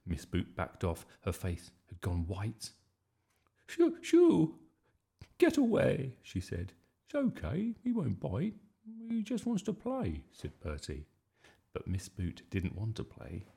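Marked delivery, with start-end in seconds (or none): 3.7-6.2 high, loud, panicky commands; alarmed shooing. A narrator's reading. Miss Boot backing off from Whiffer, alarmed shooing. Frightened teacher shooing dog. 7.0-10.2 put-on high, light child's voice; reassuring boy. A narrator's reading. Bertie calming Miss Boot about the dog, high reassurance.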